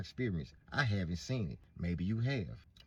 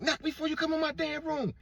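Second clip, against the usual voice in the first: put-on high, sharp girlish scold; indignant daughter; 4 dB louder than usual. Daughter scolding the boyfriend who barged in, high indignant voice. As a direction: scold him for barging in.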